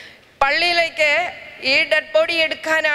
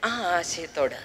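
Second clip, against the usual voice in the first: a lower, softer, hushed schoolboy; a timid boy confiding after class; a hint of a shy schoolboy, fading to a whisper; 9 semitones lower, 9 dB quieter than usual. Schoolboy voiced in story.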